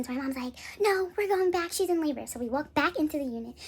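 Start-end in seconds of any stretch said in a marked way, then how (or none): 0.8-2.3 higher, louder imitation of mom; insistent mom. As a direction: firmly take charge, imitating mom.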